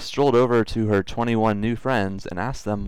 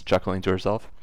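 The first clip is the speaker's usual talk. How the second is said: ordinary talk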